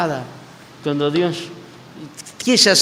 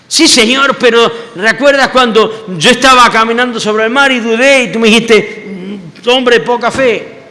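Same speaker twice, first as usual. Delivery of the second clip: higher, louder, hurried excuse; eager protest; 8 semitones higher, 6 dB louder than usual. Retold or imitated. Peter protests to Jesus, higher and louder. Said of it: "Peter answering Jesus".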